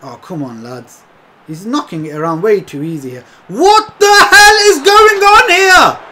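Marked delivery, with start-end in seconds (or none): 3.5-6.0 high, slow, outraged shout; drawn-out furious gamer yell; exasperated yell. Real, not acted. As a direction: yell it at the screen in disbelief. Frustrated gamer yelling at match.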